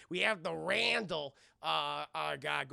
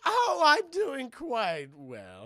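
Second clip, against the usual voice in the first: high, loud, very slow sliding drawl; sinister persona; 6 semitones higher, 9 dB louder, much slower than usual. Retold or imitated. Voicing changed Cold Stone answering worried brothers, drawn-out villain voice. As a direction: draw it out grandly as a villain, falling away.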